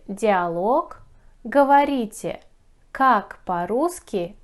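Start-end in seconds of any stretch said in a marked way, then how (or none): none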